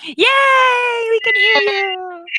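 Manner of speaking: high, drawn-out cheer; excited cheer. Real, not acted. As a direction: cheer long and high for a friend. Cheering friend's return to audio.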